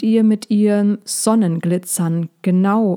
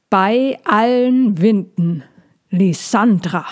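put-on slow, louder booming old voice from above; thundering old dragon; 2 semitones higher, 3 dB louder, slower than usual. A narrator's reading. Elder dragon calls down to Lysandra from the sky, slow and thundering. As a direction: boom it down through the treetops. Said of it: "donnerte es über dem Blätterdach".

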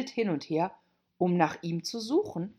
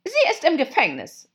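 high, loud commanding queen; evil queen; 10 semitones higher, 8 dB louder than usual. A narrator's reading. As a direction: snap it like a tyrant queen. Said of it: "sagte die Königin".

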